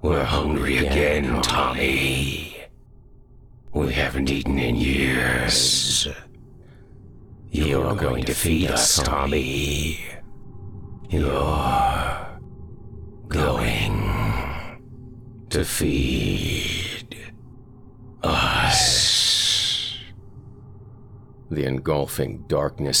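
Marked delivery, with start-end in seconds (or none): 0.0-6.1 hungry ghostly chorus, louder, ending in a slow whisper; many-voiced shadows. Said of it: "the shadows had more than one voice". 7.5-10.0 a louder ghostly threat; menacing shadow voice. Acted. 11.1-20.0 slow, each word apart, ghostly; drawn-out shadow threat. Acted.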